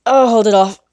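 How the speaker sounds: about to sneeze, straining; holding back a sneeze. Real, not acted. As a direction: fight off a sneeze. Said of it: "I've got another sneeze".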